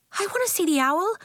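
put-on high-pitched, excited little girl; eager child. A narrator's reading. Excited girl searching for owl.

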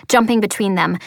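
ordinary delivery

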